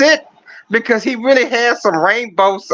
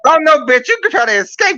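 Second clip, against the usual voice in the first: mock [name] yelling; 5 semitones higher than usual. [name] voiced chasing [name].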